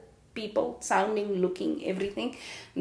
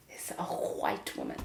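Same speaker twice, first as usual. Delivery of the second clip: whispered punchline; 14 semitones lower, 10 dB quieter than usual. Shown as a demonstration. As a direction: drop to a low whisper for the reveal. Punchline about her Afrikaans accent.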